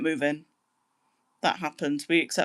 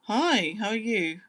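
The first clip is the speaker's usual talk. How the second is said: ordinary delivery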